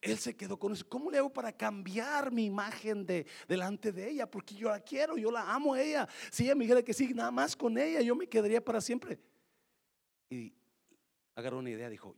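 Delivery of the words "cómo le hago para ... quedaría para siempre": desperate lover. Acted. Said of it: "él desesperado".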